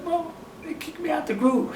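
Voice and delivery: high, soft, hurt voice; very sad. Retold or imitated. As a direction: confide in mom, holding back tears. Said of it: "I was so sad".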